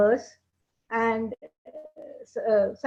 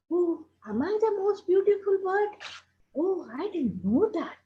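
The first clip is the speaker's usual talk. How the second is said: surprised crow; 7 semitones higher than usual. Acted.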